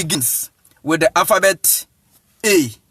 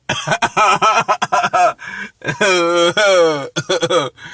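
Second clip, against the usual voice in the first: long, rolling belly laugh; real laughter. Real, not acted. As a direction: laugh heartily in several bursts. Amused by name in comment.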